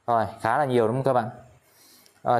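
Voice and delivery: ordinary delivery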